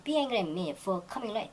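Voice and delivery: very slow, careful textbook read; slow example reading. Shown as a demonstration. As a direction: lay out every word slowly for learners. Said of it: "teacher reads example sentence". (천천히 읽는 예문)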